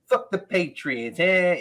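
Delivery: slow mock Boston accent; a hammed-up Boston heckle; imitating a Boston comic's put-on accent, slow; bad Boston impression. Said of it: "I'm probably doing his voice worse".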